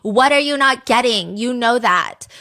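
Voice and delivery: loud, retold angry challenge; exasperated demand. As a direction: act out her impatient texting. Acting out an angry texted demand.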